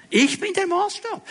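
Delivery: lightly voiced egotist, pitched high; mock egotist. Preacher voicing what self-centred people communicate, high mock self-importance. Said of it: "wir kommunizieren damit eigentlich Folgendes".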